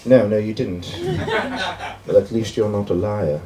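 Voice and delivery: put-on lightly done English accent, politely cutting; sweetly condescending British judge. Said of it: "the guy will come up and be like".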